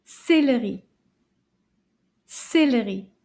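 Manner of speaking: Céleri run together as one word. Shown as a demonstration. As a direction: say Céleri briskly without breaking the syllables. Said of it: "Let's say it faster together".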